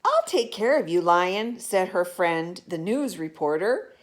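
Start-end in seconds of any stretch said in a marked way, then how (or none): 0.1-1.6 eager offer. Acted. Reporter friend offers help.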